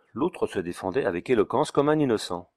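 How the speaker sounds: plain reading voice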